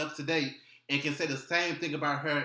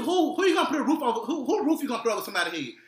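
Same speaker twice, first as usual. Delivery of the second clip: high, loud laughing rant; excited rant; 10 semitones higher, 6 dB louder, faster than usual. Real, not acted. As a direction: fire off the questions laughing.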